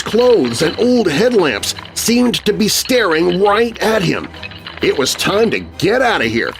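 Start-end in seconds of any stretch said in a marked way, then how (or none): none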